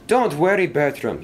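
high-pitched storybook yell. A narrator's reading. Engine yells up the hill to a stranded engine, calling out. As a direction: call up to him, pitched high. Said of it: "he yelled".

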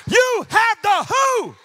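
slow, high, stretched preaching shout; shouted proclamation. Real, not acted. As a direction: shout it out word by word. Repeats it, bigger.

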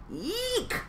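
very high squeal; yeek squeal. Acted. Froggy squeals after the kiss, very high. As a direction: squeal in embarrassment.

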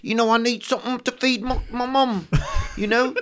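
high Cockney voice, pleading; hammed-up high Cockney whine; mock Cockney beggar. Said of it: "but then if you're like that".

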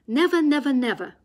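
loud high cry of never, never, never. Acted. Boggies vows loudly never to let the fox go, loud cry. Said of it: "cried Boggies".

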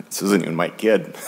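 choked up, breaking into a laugh; real welling-up with a high, breaky laugh; emotional. Real, not acted. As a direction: laugh at yourself for getting emotional. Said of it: "Sorry, I get emotional".

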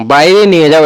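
ordinary delivery

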